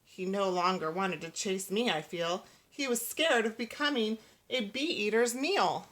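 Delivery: plain reading voice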